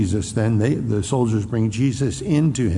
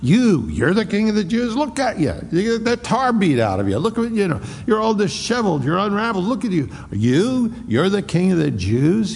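high scornful taunt at a beaten prisoner; mocking Pilate; 8 semitones higher than usual. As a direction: sneer at him, much higher. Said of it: "this is ridiculous".

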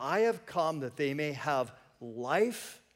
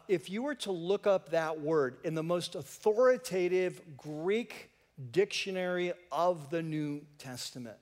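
ordinary talk, nothing marked

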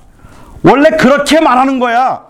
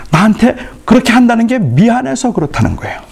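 ordinary delivery

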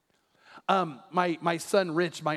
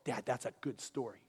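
put-on low, soft little-boy reply; sleepy kid's praise; a hint of a three-year-old; toddler voice; 7 semitones lower, 12 dB quieter than usual. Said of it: "he looks at me, three years old".

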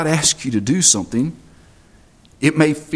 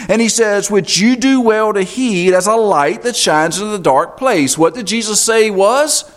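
loud, high, driving sermon voice; full-force preaching; 9 semitones higher, 5 dB louder than usual. Acted. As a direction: preach it out to the whole congregation.

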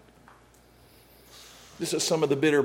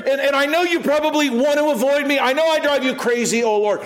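higher, loud pleading cry to God; pleading David; 8 semitones higher, 3 dB louder than usual. Acted. David crying out to God, higher and loud. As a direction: beg not to be ignored. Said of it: "what David is saying is".

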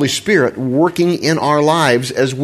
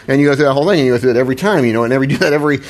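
usual speaking voice

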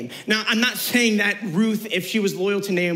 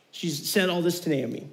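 ordinary talk, nothing marked

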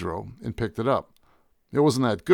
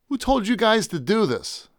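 raised, high-pitched demand; a little louder; 9 semitones higher than usual. A narrator's reading. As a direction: press them with a raised voice. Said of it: "I stressed a little louder".

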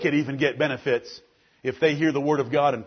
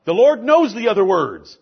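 preaching at full force; 3 semitones higher than usual. Acted. Pastor preaching at full force to the men of the congregation. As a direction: preach it hard, building to a roar.

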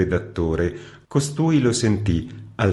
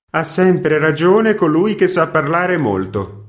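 scornful retort; 9 semitones higher, 6 dB louder than usual. A narrator's reading.

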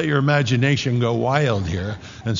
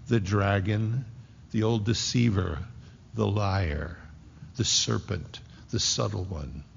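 each title softer and lower, down to a hush; fading to a hush; 4 semitones lower, 6 dB quieter than usual. Real, not acted. Preacher describing the deceiver to the congregation, sinking into a low hush. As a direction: let the voice sink as the serpent is named. Naming the dragon's sinister titles.

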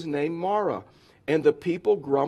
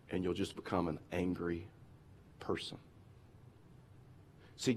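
quiet reflective preaching; 8 semitones lower, 7 dB quieter than usual. Real, not acted. Preacher asking the congregation, low and hushed. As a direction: draw them in, hushed and searching.

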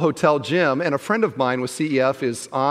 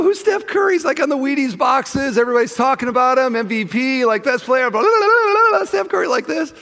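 high, loud disbelieving rant; incredulous outburst; 11 semitones higher, 4 dB louder than usual. Retold or imitated. To the woman who never heard of him, an incredulous high rant. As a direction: sputter in total disbelief that she doesn't know.

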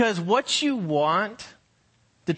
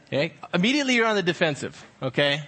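ordinary talk, nothing marked

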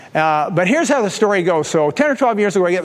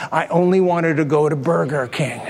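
ordinary talk

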